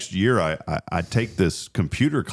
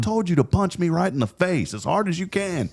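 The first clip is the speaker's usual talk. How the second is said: high-pitched, exasperated retold order; acted insistent dad; 12 semitones higher than usual. Dad ordering his son to punch him, acted insistence. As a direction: imitate a father demanding to be obeyed.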